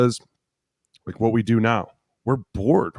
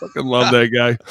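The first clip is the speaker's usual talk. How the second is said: ordinary talk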